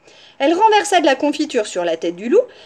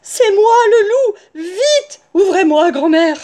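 high, loud, tearful wolf voice; distressed wolf calling, pitched up; wailing wolf; 9 semitones higher, 8 dB louder, slower than usual. A narrator's reading. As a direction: cry out urgently to be let in. Wolf pleading at the door.

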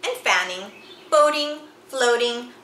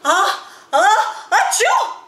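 building "ah, ah" to a big high "achoo"; sneeze; 10 semitones higher, 6 dB louder, slower than usual. Acted. The wife ruins the photo, a big high sneeze. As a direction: wind up and burst into a sneeze. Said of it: "that was a sneeze".

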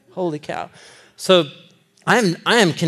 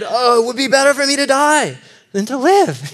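higher, melodramatic self-pity; whiny Jonah; 8 semitones higher, 4 dB louder than usual. Retold or imitated. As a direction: sigh it like a moody teenager. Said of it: "And he said".

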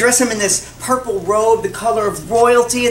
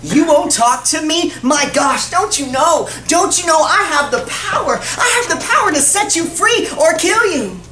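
higher, forceful outburst, building; exasperated; 6 semitones higher than usual. Acted. Pilate confronts a silent Jesus, exasperated and threatening. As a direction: demand an answer, insisting on authority.

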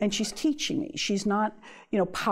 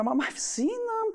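much higher, slow, drawn-out complaint; exasperated daughter; 14 semitones higher than usual. Retold or imitated. She retells answering her mother about the birds, high and drawn out. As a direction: stretch it out like a worn-down daughter.

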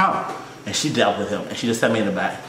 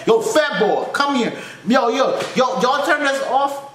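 mock angry customer; 9 semitones higher, slower than usual. Angry customer yelling at counter.

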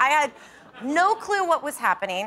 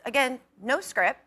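ordinary talk, nothing marked

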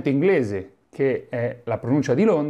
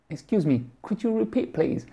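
puzzled learner; 7 semitones higher than usual. Retold or imitated. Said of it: "non so più l'inglese".

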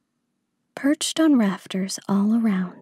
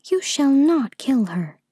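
put-on higher, louder little girl's protest; crying out; 6 semitones higher, 5 dB louder than usual. A narrator's reading. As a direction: cry out a firm command as a child. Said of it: "cried the queen's little daughter".

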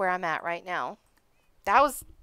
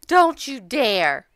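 slow warning; 4 semitones higher, 10 dB louder, much slower than usual. Real, not acted. Warning the game.

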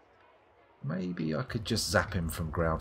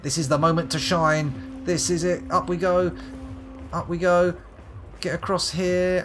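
1 psyched up; 8 semitones higher, 3 dB louder than usual. Real, not acted. Psyching himself up.

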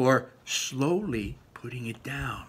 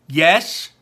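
loud, higher yes; emphatic yes; 6 semitones higher, 11 dB louder than usual. Acted. Ian answering Barley, loud and emphatic. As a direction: blurt it firmly.

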